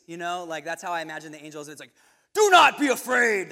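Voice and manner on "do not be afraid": loud, higher, a thundering heavenly command; "Do not be afraid" blasted out loud and high; booming angel voice. Shown as a demonstration. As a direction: boom it out like an imagined angel. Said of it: "That's how I read that in my head".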